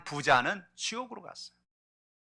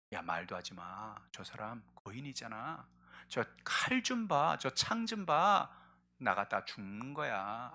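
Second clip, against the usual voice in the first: slow, much softer fearful warning; lowered, slow soldiers' fear; frightened hush; 6 semitones lower, 10 dB quieter, slower than usual. Acted. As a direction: hush him, scared of the giant.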